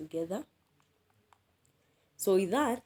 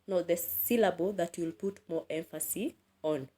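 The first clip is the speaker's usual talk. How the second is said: usual speaking voice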